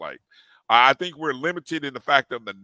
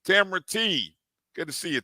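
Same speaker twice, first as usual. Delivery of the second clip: ordinary talk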